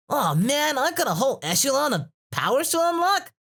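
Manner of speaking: high, hammed-up dismay; high-pitched mock whine; Goku. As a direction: imitate an overwhelmed hero's complaint. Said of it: "And now it's like".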